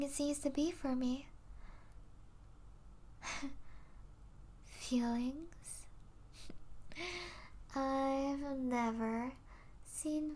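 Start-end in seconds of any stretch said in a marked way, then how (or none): 2.7-4.1 quiet, breathed half-laugh; soft scoff. Acted. Vampire confused by own feelings, soft scoff. 6.4-7.7 quiet breath of a laugh; soft scoffing laugh. Acted. Vampire dismissing feelings for a human, soft scoff.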